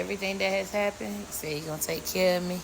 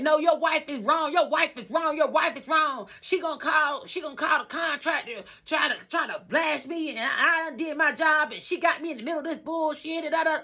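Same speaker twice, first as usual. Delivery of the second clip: mimicking angry contractor; 7 semitones higher than usual. Contractor yelling at her husband on the phone, heated mimic. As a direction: throw his heated complaint back at us. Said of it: "And he was like".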